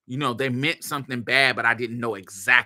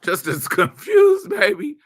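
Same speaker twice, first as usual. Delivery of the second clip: high, loud laughing burst; laughing exclaim; 7 dB louder than usual. Real, not acted. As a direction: throw it out with a laugh. Laughing emphatic aside.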